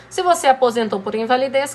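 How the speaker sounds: usual speaking voice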